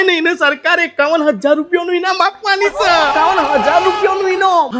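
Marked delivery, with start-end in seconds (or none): 3.1-4.8 higher, shouted disbelief at the sum; amazed disbelief. Acted. Wife hearing of the prize money, shocked shout. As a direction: blurt the amount back in shock.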